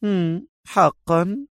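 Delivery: slow, high, drawn-out mock interest; sarcastic. A narrator's reading. Captive woman brushing off her captor's explanation, sarcastic. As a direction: stretch each word out in a high, doubting lilt. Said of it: "قالت في سخرية".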